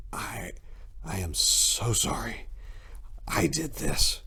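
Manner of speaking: soft, slow guilty whisper; halting whisper. Acted. Cyril confessing to the narrator, halting whisper. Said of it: "he spoke haltingly".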